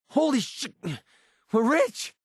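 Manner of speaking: high-pitched, astonished outburst; stunned, gleeful high cry; thrilled disbelief. Acted. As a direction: blurt it out like you just hit the jackpot. Stunned by a big find.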